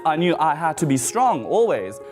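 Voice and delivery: ordinary delivery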